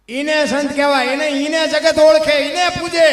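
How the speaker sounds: loud, high declaiming; fervent sermon peak; preaching at full force. Acted. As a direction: proclaim it to the crowd. Sermon climax.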